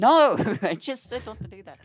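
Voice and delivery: high laughing protest. Real, not acted.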